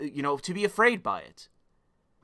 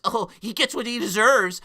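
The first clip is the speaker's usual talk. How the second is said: higher, snide mock voice; catty mockery; 7 semitones higher, 5 dB louder than usual. Streamer mimicking vindictive right-wingers, snide higher mockery. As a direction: sneer it like a petty gossip.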